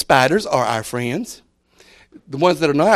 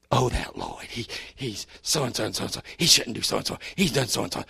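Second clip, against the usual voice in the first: lower, softer, sly gossip; 5 semitones lower, 9 dB quieter than usual. Retold or imitated. Preacher voices a gossip accusing Lloyd, low and hushed. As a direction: dish the dirt in a low hush.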